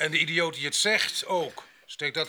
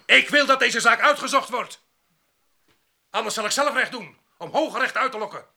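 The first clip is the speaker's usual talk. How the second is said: loud and high, demanding justice; indignant outburst; 6 semitones higher, 4 dB louder than usual. Real, not acted. Worker demanding the theft be investigated, indignant and loud. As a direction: rail against being accused.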